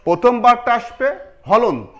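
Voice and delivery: normal talking voice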